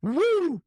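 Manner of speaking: a very high, ringing woo; high-pitched wrestling woo; Flair woo. Real, not acted. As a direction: let out the signature Flair woo. Ric Flair's signature call.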